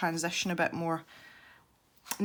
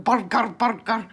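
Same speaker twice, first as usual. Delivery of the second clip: mock Scottish burger; 3 semitones higher, 8 dB louder than usual. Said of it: "he always walks around going".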